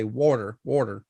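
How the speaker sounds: water said as "worter"; Philly pronunciation. Shown as a demonstration. Co-host from Philly showing how they say water, Philly accent. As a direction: say water with a rounded Philly "wawr". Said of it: "That's how we, I say".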